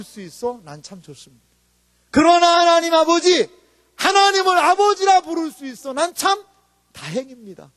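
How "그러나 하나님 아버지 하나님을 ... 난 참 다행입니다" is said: high, loud, sustained declaration; impassioned preaching. Acted. Preacher declaring a praise lyric to the congregation, impassioned. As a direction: proclaim it with drawn-out force.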